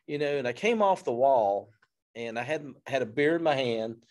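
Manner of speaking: normal talking voice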